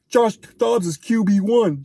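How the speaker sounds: slow, drawn-out mock of the pundit's claim; sarcastic slow imitation of a pundit; mocking quote. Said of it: "the same guy that said".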